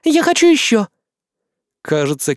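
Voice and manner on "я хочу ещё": high, loud eager catgirl; excited. Acted. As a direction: beg for more, thrilled. Excited catgirl wants more shooting. (восторженно и громко)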